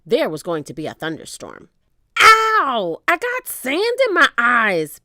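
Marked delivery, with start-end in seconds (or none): 2.2-5.0 high, loud pained wail; loud whine. A narrator's reading. Pinky with sand in the eyes, whining. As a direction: whine it out like a hurt pirate. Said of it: "Pinky whined".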